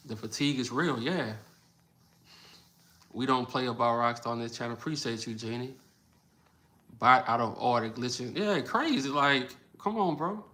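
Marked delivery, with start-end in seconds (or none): none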